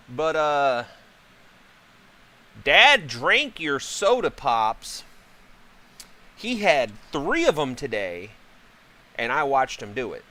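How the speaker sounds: lightly voiced kid, loud and pointed; mock tattling kid. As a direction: imitate a child telling on Dad. Voices kids tattling to mom.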